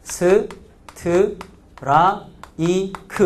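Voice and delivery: 스, 트, 라, 이, 크 each said as its own slow beat; Korean five syllables. Shown as a demonstration. Teacher counts out the Korean syllables, slow and separate. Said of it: "한국말로 해보실래요?".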